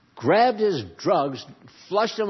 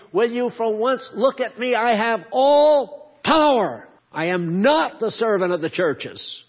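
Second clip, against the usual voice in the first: high, loud, thundering voice of God; booming voice of God; God Almighty voice; 6 semitones higher, 7 dB louder than usual. Acted. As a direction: proclaim it as the Almighty demanding attention. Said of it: "he's saying".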